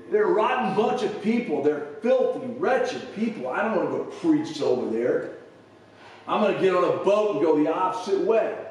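mock a resentful prophet refusing God's order; Jonah whining. Jonah complaining to God, retold.

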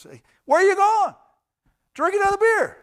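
high, loud pushy drinking buddy; hammed-up friend who won't let you leave; pushy bar friend. Bar friend loudly stopping you from leaving, high and pushy. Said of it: "whoever says".

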